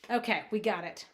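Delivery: usual speaking voice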